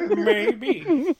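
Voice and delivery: very high laughing frog voice; Kermit the Frog. Retold or imitated. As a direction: squeak it like Kermit. Kermit bit while laughing.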